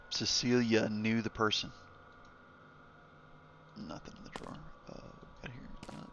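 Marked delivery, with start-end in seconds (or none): 3.8-6.1 soft unvoiced mutter; whispering to self. Real, not acted. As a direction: whisper while searching. Whispering while searching the game.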